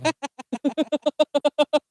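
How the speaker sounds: long high-pitched laugh; high, rolling giggle-cackle; real laughter. Real, not acted. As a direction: crack up in a drawn-out high peal. Laughing after a joke.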